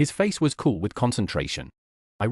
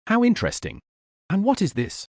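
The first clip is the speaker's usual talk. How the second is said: voiced higher, amused interest; bright character voice; 9 semitones higher than usual. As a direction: play her pitched up, intrigued. Narrator voices character, high.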